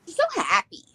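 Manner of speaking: overwhelmed by cuteness. Real, not acted. Cooing over the doll.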